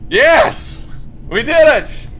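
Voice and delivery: loud, high cries of triumph; jubilant shout. Real, not acted. Streamer celebrating with viewers, jubilant shouting. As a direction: yell it like a victory.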